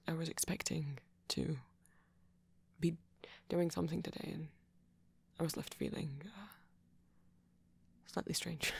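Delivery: hesitant, stammering speech with long pauses; shy, flustered date. Acted. Flustered host welcoming a date to movie night, shy and halting. Acted shy roleplay, then plain outro.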